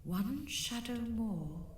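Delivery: slow ghostly insistence; ghost's exclamation. A narrator's reading. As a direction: insist slowly, eerie. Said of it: "exclaimed the ghost".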